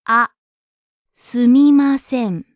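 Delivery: slow, careful すみません for learners; unhurried すみません, every sound separate; slow replay. Shown as a demonstration. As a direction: draw out すみません syllable by syllable. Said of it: "listen to the conversation again slowly".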